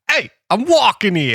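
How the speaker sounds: mock New York tough guy; slow, high New York accent. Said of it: "Jack's Boston accent".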